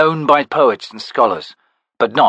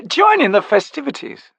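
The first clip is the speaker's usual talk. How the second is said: much higher, lighter lady's voice; gracious hostess. A narrator's reading. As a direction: sweep the guest into the party. Hostess invites guests in.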